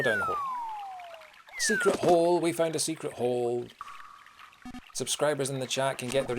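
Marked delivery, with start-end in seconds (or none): 0.0-2.0 squeaky high sing-song. Pretend. Repeats the line squeaky high.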